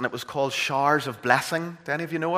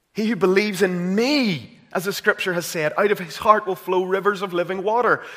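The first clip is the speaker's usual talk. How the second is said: louder, higher, ringing scripture; preaching at full force; 7 semitones higher, 6 dB louder than usual. Acted. Preacher repeating the verse to the church, fervent preaching. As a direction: proclaim the verse with growing fire. Quoting Jesus again, rising.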